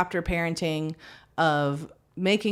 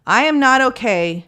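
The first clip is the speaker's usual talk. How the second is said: loud, raised-pitch declaration of overwhelm; saying it out loud; 7 semitones higher, 9 dB louder than usual. Retold or imitated. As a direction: announce it firmly, acting out a parent at breaking point. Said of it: "I will verbally say out loud".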